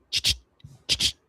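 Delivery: short doubled "ch-ch" noises; voiceless "ch-ch" correction; mock trainer's hiss. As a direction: imitate a dog trainer's sharp corrective sound. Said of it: "I'm not gonna be like".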